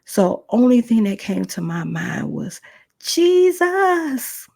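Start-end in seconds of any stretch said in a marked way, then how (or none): 3.0-4.5 drawn-out, high, loud cry; calling on Jesus. Retold or imitated. Woman under attack calls on Jesus, desperate loud cry. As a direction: cry out the name for rescue. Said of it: "I called on the name of Jesus".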